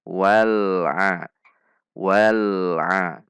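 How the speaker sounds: "wal'a" with l held briefly, flowing into a; correct lam sukun. Shown as a demonstration. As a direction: link the l of "wal'a" smoothly to the a. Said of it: "Anda bisa melatihnya dengan".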